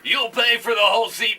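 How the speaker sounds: high, loud announcer hype; monster truck announcer. Acted. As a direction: shout it like an arena ad.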